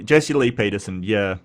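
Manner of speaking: ordinary delivery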